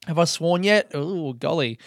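ordinary delivery

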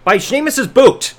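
ordinary talk, nothing marked

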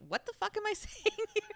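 ordinary talk, nothing marked

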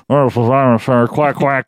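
tinny AM radio Imus voice; Imus impression. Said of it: "That sounded like Imus".

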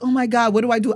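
loud, panicked "what do I do"; panicked cry. Retold or imitated. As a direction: blurt it out in sudden panic. Brake failed, panicking at truck.

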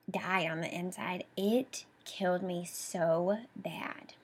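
2 whispered, barely audible, near tears; 3 let the memory crush the voice to a whisper; 1 broken whisper. Real, not acted.

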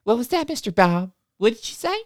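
high, syrupy sing-song teacher; sugary teacher voice. Retold or imitated. Teacher welcoming the kids to music class, high and syrupy. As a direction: gush over the children, sweetly high.

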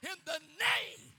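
high, loud, slow preacher's cry; drawn-out pulpit shout; shouted invocation. Real, not acted. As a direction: proclaim it with full force. Invoking name while preaching.

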